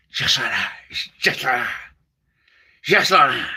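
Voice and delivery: low growl then whispered; mumbled Cooper catchphrase; Tommy Cooper. Retold or imitated. Comic doing Tommy Cooper's catchphrase, low. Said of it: "my Tommy Cooper impression".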